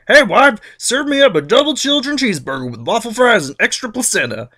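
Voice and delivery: high, loud bossy diner order; Cronus ordering. Retold or imitated. Cronus ordering his wife like a diner customer. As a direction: bark a fast-food order. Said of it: "Cronus is like".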